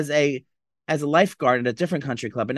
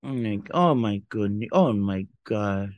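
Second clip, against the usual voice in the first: mock shock; 8 semitones lower, slower than usual. Shocked reaction to the picture.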